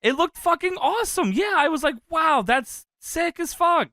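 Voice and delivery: high-pitched, thrilled gushing; awed excitement. Real, not acted. Fan raving about a midair kick, high awed excitement. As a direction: marvel at it, drawing out the end. Gushing over a spot he loved.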